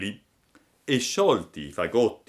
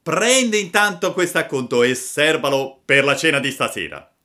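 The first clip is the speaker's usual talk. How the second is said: loud, raised taunt with a punch; bold shout; 6 semitones higher, 8 dB louder than usual. A narrator's reading. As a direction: shout as the gang's daredevil. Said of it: "gridò il più ardito". (grido spavaldo)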